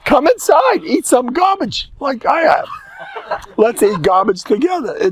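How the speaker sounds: high, loud, laughing invitation to eat; cheerful mock host. Host inviting guest to share poor food, high loud laughing. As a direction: call them in gleefully, high and loud.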